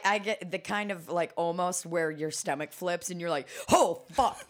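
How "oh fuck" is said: retold alarm. Acting out near-crash reaction.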